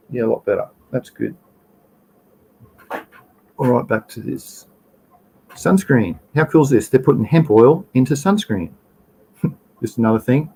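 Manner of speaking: usual speaking voice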